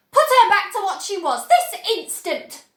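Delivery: high, loud command; furious demand. A narrator's reading. Mother furiously ordering Wonka, high and loud. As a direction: demand it at once.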